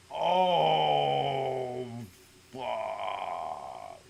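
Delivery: the dread of being caught as she walks up; panicked oh fuck. Retold or imitated. Caught by mom, a long sinking panicked groan.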